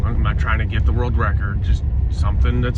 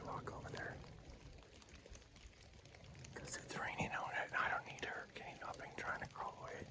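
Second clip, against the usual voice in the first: hushed, shaken whisper after the shot; hunter's whisper; 25 dB quieter than usual. Real, not acted. Hunter telling viewers the doe is down, shaking, whispered. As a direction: keep it whispered, rattled by adrenaline.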